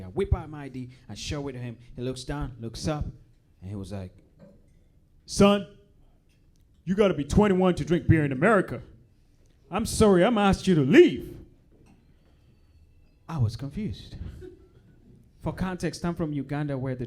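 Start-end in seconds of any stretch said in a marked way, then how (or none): 5.2-11.4 stern bartender. Retold or imitated. Bartender refusing underage drinker.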